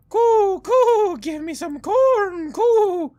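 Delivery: very high, loud cooing squawk; cooing bird. Acted. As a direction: squeal it out like a bird begging. Plays a bird begging for corn.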